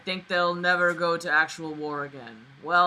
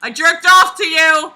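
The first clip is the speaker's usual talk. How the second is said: loud, high, slow blurted line; mock confession shout; 11 semitones higher, 17 dB louder than usual. Reader inventing the narrator's crazy news as a mock shouted confession. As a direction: blurt it out as a joke.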